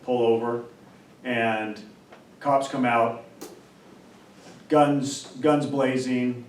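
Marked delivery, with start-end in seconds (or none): none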